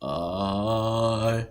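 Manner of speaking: "I" held out as a long vowel. Shown as a demonstration. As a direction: stretch "I" into one sustained sound. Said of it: "is a long I".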